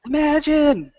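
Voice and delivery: high, stretched-out song request; crowd call-out. Acted. Kin requesting the next song from the player, high call-out.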